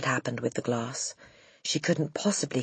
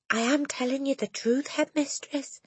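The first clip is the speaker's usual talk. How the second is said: a hint of a gentle teacher; 11 semitones higher than usual. Teacher insisting on the truth.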